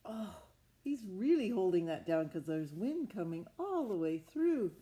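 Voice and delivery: ordinary delivery, nothing marked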